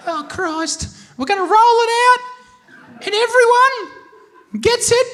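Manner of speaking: high, slow put-on voice; strained proclaiming woman. Woman pushing on with her pledge as tics start, strained proclaiming. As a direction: stretch the announcement out, high and loud. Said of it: "She's like".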